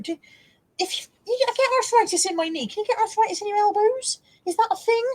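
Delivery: wondering aloud about aching elbows; incredulous. Real, not acted. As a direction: ask it in genuine disbelief. Surprised question about arthritis.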